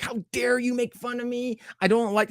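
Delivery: high-pitched mock indignation; super pissed. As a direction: imitate an offended celebrity's outrage. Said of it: "[name] gets super pissed".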